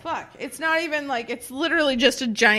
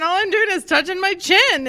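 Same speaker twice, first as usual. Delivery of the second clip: normal talking voice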